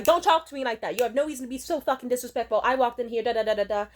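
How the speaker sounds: loud, fast angry outburst; mock furious rant at the clerk; popping off. Friend yelling back at the rude office lady. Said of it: "started popping off".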